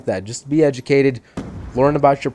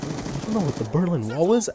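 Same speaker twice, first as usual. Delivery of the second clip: soft, raised incredulous question; retold disbelief; 5 semitones higher, 5 dB quieter, faster than usual. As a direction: ask in hushed mock astonishment, voice lifting. Retelling his disbelief at classmate.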